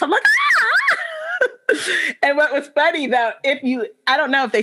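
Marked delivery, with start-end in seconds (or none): 0.2-2.1 very high scream into laughing; retold scream of joy, very high, melting into a laugh; excited scream. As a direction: shriek like you won the lottery. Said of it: "I start screaming like I won".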